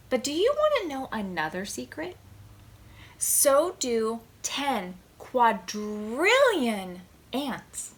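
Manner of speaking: ordinary talk, nothing marked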